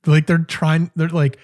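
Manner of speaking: usual speaking voice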